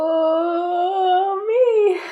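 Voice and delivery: drawn-out, high and slow owl. Acted. The owl leads the player on, drawn out high and slow. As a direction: stretch every word like a solemn owl.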